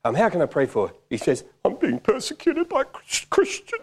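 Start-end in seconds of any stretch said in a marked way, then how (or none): 1.6-3.8 very high, drawn-out mock whine; mock complaint. Man complains to preacher of persecution, mock-plaintive squeal. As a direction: squeal it like a wounded victim.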